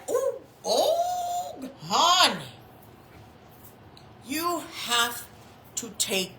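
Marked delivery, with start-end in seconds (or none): 0.1-2.5 sassy put-down. Retold or imitated. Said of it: "And it was like".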